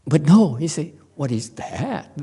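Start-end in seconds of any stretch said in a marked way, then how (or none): 1.1-2.1 elegant reply. Retold or imitated. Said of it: "He's so elegant".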